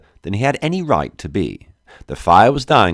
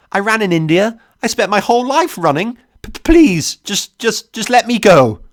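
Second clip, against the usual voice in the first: stammering confession, rising to high panic; frightened, high, stuttering plea; struggling to stay steady; 13 semitones higher, 6 dB louder than usual. A narrator's reading. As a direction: start controlled, build to loud desperate begging. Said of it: "trying to keep my voice steady".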